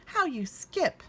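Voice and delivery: high, delighted cub; little bear voice. Acted. Little Bear delighted at Grandmother skipping, high cub voice.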